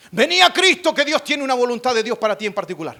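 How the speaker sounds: high, loud opening that eases as it runs on; preacher's altar call. Acted. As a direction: call out like a rousing evangelist. Evangelist's call acted out.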